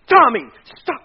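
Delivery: sudden loud, high yell; yelled command. Retold or imitated. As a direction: yell at your son to stop. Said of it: "I mean, I yelled".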